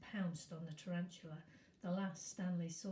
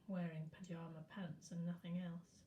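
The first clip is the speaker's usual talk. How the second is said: plain reading voice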